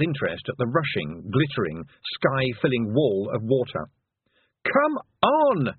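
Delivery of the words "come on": high, urgent yell. A narrator's reading. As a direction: yell to hurry them away from danger. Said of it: "Tiffany yelled".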